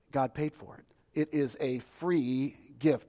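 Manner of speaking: ordinary talk, nothing marked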